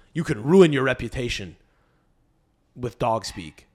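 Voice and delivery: ordinary talk, nothing marked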